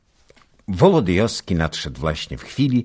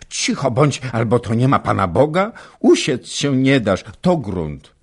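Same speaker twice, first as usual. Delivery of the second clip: lively scolding wife; 7 semitones higher, 7 dB louder than usual. A narrator's reading. Baśka scolding husband playfully.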